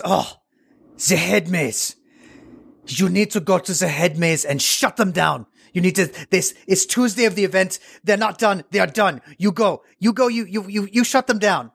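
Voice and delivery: flamboyant boss imitated in a rough French-sounding accent; mock French-like accent, pitch climbing as the orders pile up; put-on foreign accent. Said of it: "I'm doing the accent anyway".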